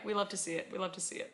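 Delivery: usual speaking voice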